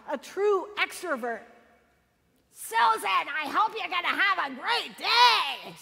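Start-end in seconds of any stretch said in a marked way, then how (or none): 2.5-5.7 raspy, higher, louder greeting; a raspy woman rushing out to greet passersby; raspy exuberant neighbor. Retold or imitated. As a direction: call out like a cheerful raspy neighbor. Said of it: "a very raspy voice".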